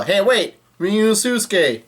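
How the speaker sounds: high-pitched call after someone; mock yell. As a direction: imitate people crying out, at moderate volume. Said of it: "And people yell".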